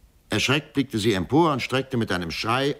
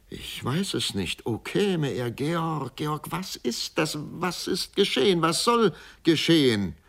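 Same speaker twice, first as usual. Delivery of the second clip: frightened questions; 9 semitones higher than usual. Acted.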